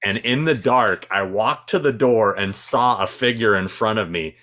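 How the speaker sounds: ordinary talk, nothing marked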